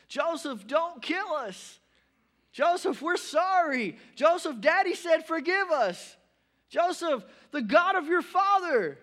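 high, loud desperate pleading; begging for my life. Acted. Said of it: "his brothers were sitting here begging for their lives".

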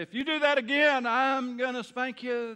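put-on loud, high, slowed threat to a child; stern parent warning. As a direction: lay down the law like a parent. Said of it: "We say".

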